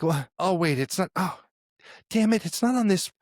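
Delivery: high, rising panic as mom fumbles; flustered mom. Retold or imitated.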